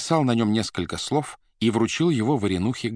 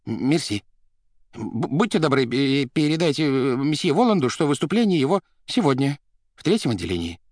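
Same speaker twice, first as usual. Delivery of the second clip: higher, stammering polite request; flustered; 6 semitones higher, 4 dB louder than usual. A narrator's reading. Administrator leaving a message for the artiste, flustered. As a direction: stumble through it, thrown off balance. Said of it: "растерянно сказал Варенуха".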